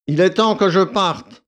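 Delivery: brisk lordly declaration; curt announcement. A narrator's reading. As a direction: announce your departure imperiously. Valet abruptly announces departure.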